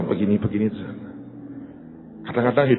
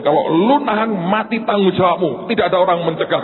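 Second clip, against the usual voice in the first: high, forceful retold threat; acted-out emergency ultimatum; defiant warning; 7 semitones higher, 3 dB louder than usual. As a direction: warn off the police, speeding up at the end.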